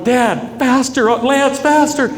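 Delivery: high, panicked plea; freaking out. Retold or imitated. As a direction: call out in mock panic to the boat driver. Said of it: "so I'm freaking out. Now I'm crying".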